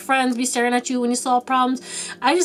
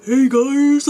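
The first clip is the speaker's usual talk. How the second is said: loud, put-on booming greeting; mock loud voice; 2 semitones higher, 7 dB louder than usual. Imitating a loud friend suddenly greeting people, mock booming. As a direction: burst in with an exaggerated loud voice. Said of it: "or just a loud voice".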